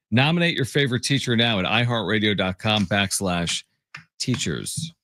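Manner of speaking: upbeat radio promo voice; ad read. Acted. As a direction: read it like a sponsor spot. Reads a sponsored teacher promo.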